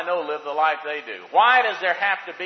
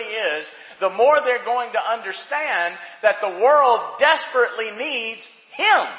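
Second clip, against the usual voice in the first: raised, emphatic preacher's voice, high in pitch; impassioned preaching; 6 semitones higher, 4 dB louder than usual. Real, not acted. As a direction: drive each phrase home to the congregation. Preaches with rising force.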